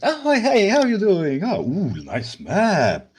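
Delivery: very high, loud, overfriendly hello; hammed-up cheery greeting; Tyrius greeting. Tyrius greets Aaron at her map, very high and loud.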